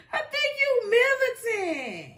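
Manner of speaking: high, slow squeal through laughter; high-pitched, laughter-squeezed accusation; laughing disbelief. Real, not acted. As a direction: draw it out while cracking up. Repeats it while cracking up.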